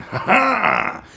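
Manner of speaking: high gleeful cackle; mock villain laugh. Knockoff factory thief gloating over the stolen mold. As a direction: crow like a cheeky counterfeiter. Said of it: "stole the mold and went".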